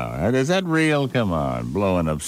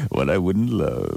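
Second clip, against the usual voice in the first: ordinary talk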